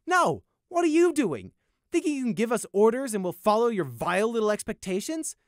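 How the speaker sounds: high, loud outraged shouting. Acted. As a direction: confront him in outrage. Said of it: "scowled in outrage".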